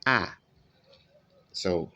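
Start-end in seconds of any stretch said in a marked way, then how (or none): 0.1-0.3 short æ said as a teacher's model; recalling the æ sound; modelled vowel. Shown as a demonstration. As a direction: say the short æ once, clearly. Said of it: "we've looked at A".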